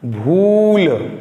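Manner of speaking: भूल with its long ऊ stretched very slowly; slow drawn-out model word. Shown as a demonstration. Teacher models भूल for pupils to repeat, stretched slowly. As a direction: model भूल for the class to echo, its ऊ held long. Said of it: "बोलिए मेरे साथ".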